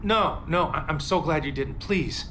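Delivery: high, urgent begging; desperate not to lose her; a cracking plea; pleading. Acted.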